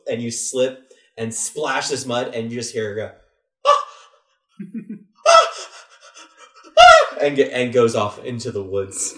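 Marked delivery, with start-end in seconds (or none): 3.7-7.2 three high piercing shrieks; shrieking screams. Acted. A splashed lady shrieking as she flees, piercing screams. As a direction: shriek in disgust as mud hits.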